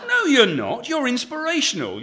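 high, laughing insistence; eager protest. Real, not acted. Writer protesting to his heroine, high and laughing. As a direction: insist brightly she is inspiring.

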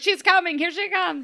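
high, urgent mock French accent; Lumiere's French accent. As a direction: sound the alarm in bad French accent. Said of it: "Lumiere is still lit".

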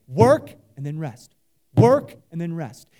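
"Work" punched low, pause, "and then rest" released; measured cadence. Shown as a demonstration. Preacher voicing God's rhythm to the congregation, measured cadence. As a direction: hit WORK hard, ease off on "rest". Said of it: "this is the cadence".

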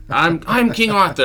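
high, loud mock-regal proclamation; King Arthur announcing himself. King Arthur introducing himself to peasants, mock-regal proclamation. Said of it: "and he's like".

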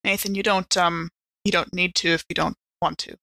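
normal talking voice

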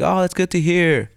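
imitating the boss asking favour; mock boss. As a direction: slide into the ask sweetly.

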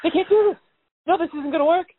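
high, frantic rising alarm; panicking. Retold or imitated. Retelling his quarantine tennis panic to a friend, frantic high voice. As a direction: spiral into a shrill panic. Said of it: "panic attack moment where I'm like".

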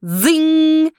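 high zinging launcher sound; machine zing. Acted. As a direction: voice the disc machine firing. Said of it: "the machine went".

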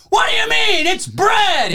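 dad yelling at the Subway clerk; imitating a furious dad. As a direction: squawk it like a dad losing it over bread. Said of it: "My dad fully flipped out".

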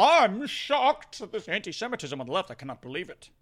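a hint of a fake-shocked newsman, trailing soft; lightly mock the anchor's disbelief. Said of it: "the Jake Tappers of the world".

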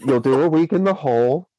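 ordinary delivery

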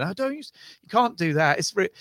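high-pitched mock telling-off; embarrassed scolding. Companions stopping each other doing the accent, mock scolding. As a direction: lightly imitate a friend trying to stop someone.

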